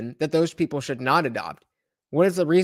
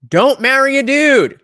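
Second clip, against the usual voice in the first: higher, much louder, drawn-out exclamation; loud punchline; 8 semitones higher, 11 dB louder, slower than usual. Real, not acted. Guest offers a blunt solution to the debate, loudly exclaimed. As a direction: belt it out slowly as the obvious solution.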